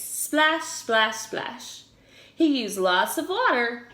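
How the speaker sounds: ordinary delivery, nothing marked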